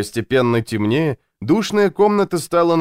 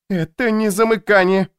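insistent, higher-pitched denial; stubborn; 9 semitones higher than usual. A narrator's reading. Louisa insists it is no short circuit, stubborn. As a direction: dig in and contradict him. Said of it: "упрямо пробормотала Луиза".

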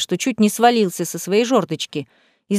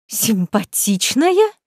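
stunned; much slower than usual. Acted. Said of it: "выдохнула Лемейна".

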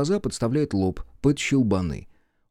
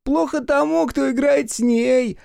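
much higher and louder, slow gloat; 4 dB louder, slower than usual. A narrator's reading. The bird gloats over the centaur's lost match, high and slow. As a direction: savour the other's loss, drawn out. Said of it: "злорадствовал".

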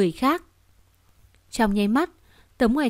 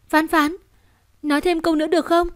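eager plea; 6 semitones higher, faster than usual. Acted. Mother begs child to speak.

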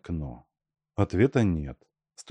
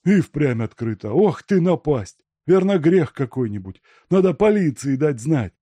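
raised, loud voice of alarm; alarmed yard-keeper; 7 semitones higher, 6 dB louder than usual. A narrator's reading. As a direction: exclaim with mounting worry. Yard-keeper alarmed by open window. (встревоженно, громко причитая)

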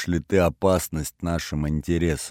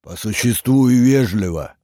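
louder, higher growled reminder; growled order; 5 semitones higher, 5 dB louder than usual. A narrator's reading. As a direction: growl it out sternly, pushing the volume. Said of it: "прорычал первый голос".

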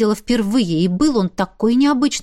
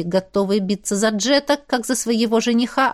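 plain reading voice

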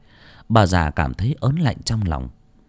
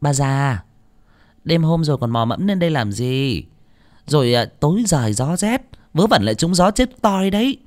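high, dismissive scolding, rising higher; scornful daughter-in-law; 8 semitones higher than usual. A narrator's reading. Daughter-in-law sending her mother-in-law back downstairs, scornfully. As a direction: shoo the old woman away with contempt. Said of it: "vảnh cái môi mỏng lên mà hỏi".

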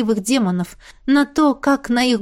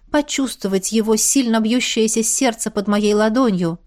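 ordinary delivery

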